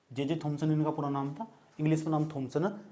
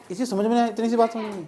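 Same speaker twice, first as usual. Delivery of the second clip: higher, louder disbelief; exasperated outburst; 8 semitones higher, 8 dB louder, faster than usual. Real, not acted. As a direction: scold the class for not getting it. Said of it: "exasperated at class". (झुंझलाकर ऊँची आवाज़ में)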